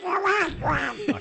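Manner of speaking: a high, slow mock character voice; attempted impersonation. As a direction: try a squeaky character voice and fail. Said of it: "in their best impersonation".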